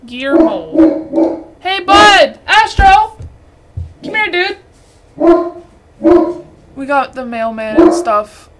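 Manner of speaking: high, loud cooing at the dog; real delighted calls to his pet; excited dog greeting. Real, not acted. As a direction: call the dog over happily.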